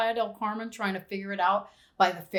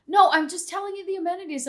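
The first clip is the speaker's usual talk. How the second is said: insistent clerk; 9 semitones higher, faster than usual. Retold or imitated. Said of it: "And he's like".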